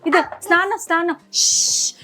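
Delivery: put-on high, playful singsong for a toddler; sweet nursery singsong; baby-talk singsong. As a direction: coax the baby with a lilting game voice.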